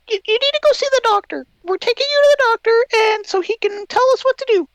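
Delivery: high, fast fussing mom voice; squeaky worried mother, rushing. Retold or imitated. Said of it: "my mom's all worried. She's like".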